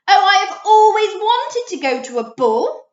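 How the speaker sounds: high, loud, delighted cry; loud high gushing delight. A narrator's reading. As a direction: gush with longing. Wolf excited in story.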